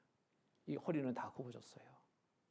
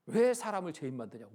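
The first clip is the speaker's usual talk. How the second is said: louder mock-angry protest; retold indignation; 3 semitones higher, 9 dB louder than usual. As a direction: act out an offended old woman's outburst. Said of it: "막 역정을 내셔요". (역정 내는 할머니 흉내)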